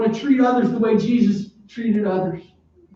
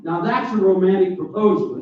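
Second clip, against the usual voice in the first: ordinary talk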